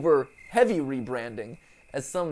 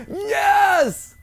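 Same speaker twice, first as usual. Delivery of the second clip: excited shout; 13 semitones higher, 7 dB louder than usual. Real, not acted. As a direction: gasp then high victory cry. Jump scare then triumph.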